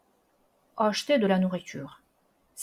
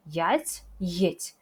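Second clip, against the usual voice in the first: usual speaking voice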